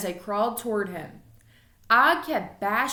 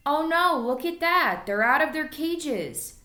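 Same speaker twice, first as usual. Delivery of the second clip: alarmed surprise; 6 semitones higher, 4 dB louder than usual. Acted. Teacher discovers escaped pets.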